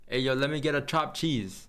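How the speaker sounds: quick, lightly played New York accent; mock New York accent. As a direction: order a chopped cheese like a New Yorker. New York bodega impression.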